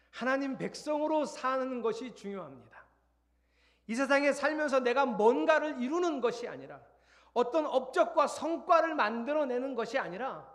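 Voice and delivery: loud, high-pitched preaching; forceful preaching. Real, not acted. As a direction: drive each phrase home to the congregation at full force. (높고 큰 소리로 힘주어 설교)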